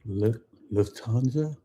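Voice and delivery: Lufthansa tried haltingly as "l-liv-TAHN-za"; hesitant pronunciation attempt. Real, not acted. Learner repeating after coach, hesitant Lufthansa. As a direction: break after the L, then venture Lufthansa as a question. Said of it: "No, I can't".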